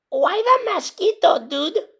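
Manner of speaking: high whispered little dog; tiny voice. A narrator's reading. Smallest dog asking the stranger, high and whispered. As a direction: squeak it out small.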